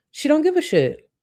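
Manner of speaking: high mock voice of her; a lightly hammed-up Chantel voice; mocking imitation. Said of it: "if we think that's racist".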